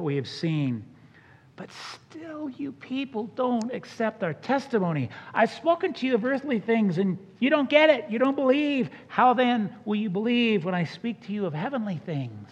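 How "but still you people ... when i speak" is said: heightened scripture reading. Acted. Preacher reading Jesus' rebuke aloud.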